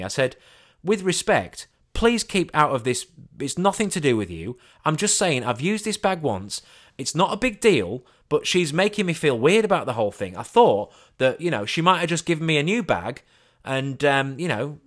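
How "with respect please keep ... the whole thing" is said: raised voice. Retold or imitated. Retold heated argument in store.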